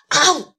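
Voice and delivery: very loud roaring "awoo"; lion roar. Acted. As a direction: roar right at the giraffe. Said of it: "对着长颈鹿大声一吼". (很响的狮子大吼)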